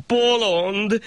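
high scornful retort; the shah rebuking the poor man; sharp high insult; snapping shah. Acted. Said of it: "rival rá a sah".

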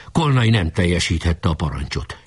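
ordinary delivery

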